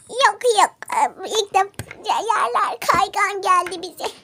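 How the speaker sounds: normal talking voice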